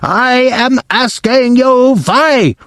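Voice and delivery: furious demand for an answer; Grand High Witch screaming. Acted. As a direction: stretch WHY into a high screech. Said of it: "she screamed".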